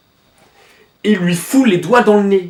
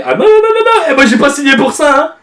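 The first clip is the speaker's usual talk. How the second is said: much higher and louder, hammed-up protest; mock panicked refusal; 9 semitones higher, 6 dB louder than usual. Reader playing the trapped character, in mock panic. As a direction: squeal no no like you've been tricked.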